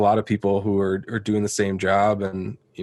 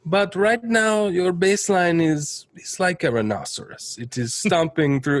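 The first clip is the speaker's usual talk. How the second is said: fake Italian accent; 8 semitones higher than usual. Producer mocking a bassist, in a fake Italian accent. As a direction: stretch it out like a cartoon Italian, pitched up. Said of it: "say in a fake Italian accent".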